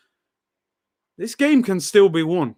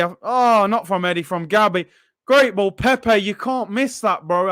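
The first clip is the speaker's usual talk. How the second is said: ordinary talk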